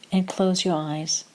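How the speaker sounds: calm meditation voice with long pauses; short, measured phrases, leaving room to breathe; guided meditation. Acted. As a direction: guide them gently into stillness, unhurried.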